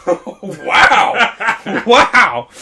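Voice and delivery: loud, laughing disbelief; mock amazement. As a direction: stand back and exclaim at the chaos. Retold amazement at chaos.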